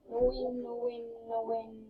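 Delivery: very soft, sinking murmur; dejected mutter. Real, not acted. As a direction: mumble it to yourself, defeated. Muttering after a lost match.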